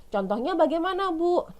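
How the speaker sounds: a schoolchild asking the teacher for an example, high and eager; pupil asking teacher. Acted. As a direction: play a curious student raising a question.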